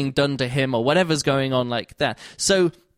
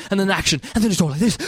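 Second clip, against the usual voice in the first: louder, pumped-up narrator voice; pumped-up action read; 5 semitones higher, 3 dB louder, faster than usual. Shown as a demonstration. Mocking narrators, he pumps up the action louder. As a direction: punch it up like an action scene. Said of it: "then there's suddenly action".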